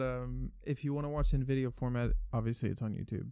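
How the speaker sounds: usual speaking voice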